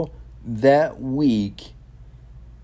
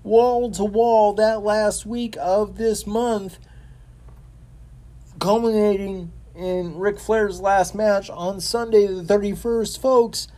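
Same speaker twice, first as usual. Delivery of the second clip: excited gushing; 9 semitones higher than usual. Real, not acted. Raving about a wrestling week.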